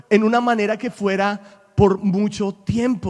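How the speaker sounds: loud, high, driving sermon voice; preacher building up to full force at the pulpit; fiery preaching. Acted. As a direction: preach it hard to the congregation.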